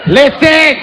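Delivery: loud mock call. Rafting guide demanding attention, loud mock call. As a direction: snap the word listen loud to grab them.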